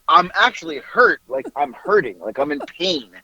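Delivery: normal talking voice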